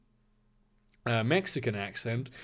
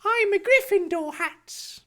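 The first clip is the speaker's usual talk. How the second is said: high, slow cartoonish voice; squeaky high, drawn-out character line; put-on character voice; 4 dB louder than usual. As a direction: pitch it way up and draw it out.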